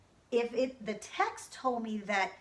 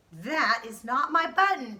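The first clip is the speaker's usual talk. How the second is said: raised, upset cry; 3 semitones higher, 8 dB louder than usual. Acted. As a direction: cry it out in dismay. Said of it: "cried Toad".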